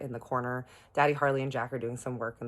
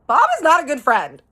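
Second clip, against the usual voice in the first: indignant outburst; 14 semitones higher, 16 dB louder than usual. Retold or imitated. Indignant about Bob's spending.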